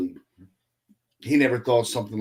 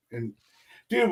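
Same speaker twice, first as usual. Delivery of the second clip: ordinary talk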